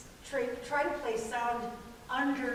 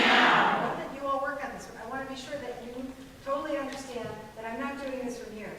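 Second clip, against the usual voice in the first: ordinary delivery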